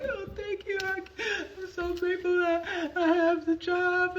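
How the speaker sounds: soft high crying voice for her daughter; imitated tearful thanks. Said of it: "she's like".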